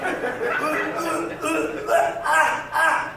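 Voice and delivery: halting stuck syllables; stuttering. Retold or imitated. Brother yelled at by mom, stuttering helplessly. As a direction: stammer without reaching a word. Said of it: "would always stutter".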